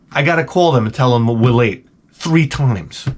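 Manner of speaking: ordinary talk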